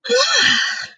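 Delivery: high, disgusted groan. A narrator's reading. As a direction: groan at a foul taste. Sophie tastes the snozcumber.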